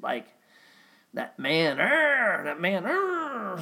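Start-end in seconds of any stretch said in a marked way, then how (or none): 1.8-2.4 high, loud mock roar "Arr"; a playful angry dad bark; scary dad growl. Imitating gruff dad, mock scary roar. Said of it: "you know, scary voice". 2.8-3.6 very high mock roar "Arr"; playful monster bark; scary dad growl. Imitating gruff dad, mock scary roar. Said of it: "you know, scary voice".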